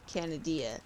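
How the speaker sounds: "Canadia" as CAN-uh-DEE-uh; spelling pronunciation. Shown as a demonstration. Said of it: "they should be from".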